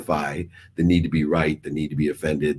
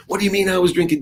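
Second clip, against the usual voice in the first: much higher hammed-up dad cutting in; mock angry dad; 14 semitones higher, faster than usual. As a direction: caricature the yelling dad, pitched up. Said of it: "she would have said".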